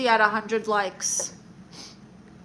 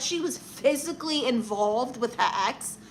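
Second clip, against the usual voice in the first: softer, euphemistic mimicry; faux-delicate echo of his wording; mock considerate; 4 dB quieter than usual. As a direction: mockingly quote it as if it were polite. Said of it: "make it sound all nice and".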